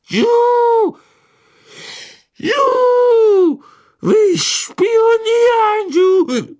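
hoch und laut, halb heulend; schreiende Elfe. A narrator's reading. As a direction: kreisch betrunken und verzweifelt. Winky voiced by narrator. (hohe, laut heulende Elfenstimme)

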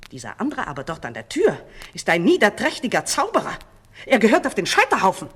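fast, loud accusation; angry denunciation. A narrator's reading. False queen denouncing the man at the door, angry. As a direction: condemn him with rising force.

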